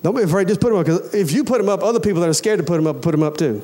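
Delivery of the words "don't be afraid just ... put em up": ordinary delivery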